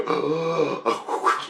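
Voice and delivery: exaggerated gasp, imitating the psychics; big imitated gasp; mock shocked gasp. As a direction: gasp as if horrified by a vision.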